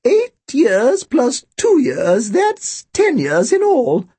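put-on thin, high old-woman voice; high-pitched elderly shopkeeper reckoning aloud; Missus Bodega. A narrator's reading. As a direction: trill along like a birdlike old lady. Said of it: "Her voice was thin and high-pitched".

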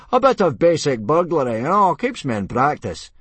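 loud, high, hearty brushing-off; big-voiced humble brag; modest bellow. A narrator's reading. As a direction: bellow it cheerfully as if it's nothing. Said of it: "he bellowed modestly".

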